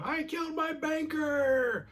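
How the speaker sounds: really loud yell, very high and drawn out; Sam. Retold or imitated. Voice in Marty's ear blurts his crime, yelling really loud. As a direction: stretch it into a high, strained scream. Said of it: "Sam yells really loud".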